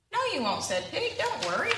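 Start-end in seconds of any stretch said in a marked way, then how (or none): 0.1-0.6 high, light pig voice brushing off the fear; cheerful Pig. Acted. 1.2-1.7 high, light pig voice brushing off the fear; cheerful Pig. Acted.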